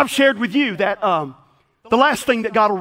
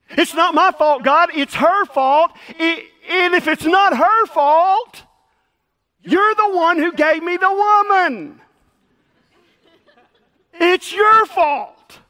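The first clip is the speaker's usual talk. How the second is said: higher, louder, slow whining with a sob in it; whiny blaming Adam; 8 semitones higher, 6 dB louder, slower than usual. Retold or imitated. Adam whines to God that it's everyone else's fault, loud and tearful. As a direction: wail the excuse like a child caught out. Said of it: "He gets it from his original father".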